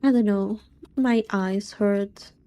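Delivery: ordinary delivery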